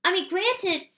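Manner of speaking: rising, loud outraged sarcasm; indignant rant. Real, not acted. Venting at the man who gave him a quarter, furious and loud.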